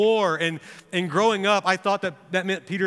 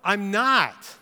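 high, hurried string of no's, then firm; denial; 6 semitones higher than usual. Retold or imitated. Peter denies it to the crowd, protesting. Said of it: "looks at the crowd and says".

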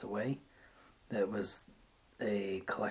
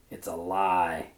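throaty; 4 semitones lower, 4 dB louder, slower than usual. A narrator's reading. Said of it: "made a sound in his throat".

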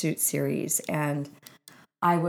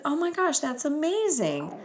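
delighted; 10 semitones higher than usual. Retold or imitated. Retelling own delighted reply.

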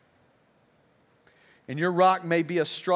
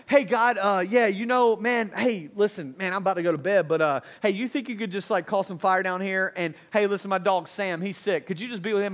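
mock casual prayer, full of offhand hesitations; half-hearted prayer. Sleepy believer praying before bed, casual and half-hearted. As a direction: toss it off like a lazy chat with a buddy. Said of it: "I'm sure Elijah didn't say".

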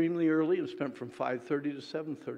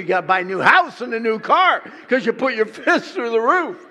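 much louder and higher, laughing through the punchline; escalating exaggeration; 6 semitones higher, 13 dB louder than usual. Preacher to the crowd, laughing mock outrage. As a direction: pile it on until you crack up. Escalating rant about patching wall.

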